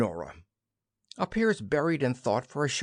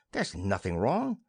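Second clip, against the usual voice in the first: ordinary delivery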